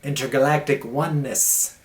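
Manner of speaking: monk flatteringly praising the other, theatrical; Jim Carrey style gushing. Pretend. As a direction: ham up a grand cosmic compliment. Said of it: "I'm throwing some Jim Carrey lines at you".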